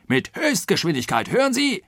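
high, insistent angry outburst; riled. A narrator's reading. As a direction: drum it into him indignantly. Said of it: "in Harnisch brachte". (aufgebracht, mit hoher Stimme)

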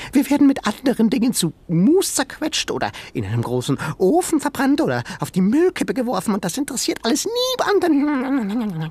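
high, fast, distraught animal voice; tiny creature voice. A narrator's reading. Sengi telling Ben their fate, high creature voice. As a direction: rush the doom like a panicked critter.

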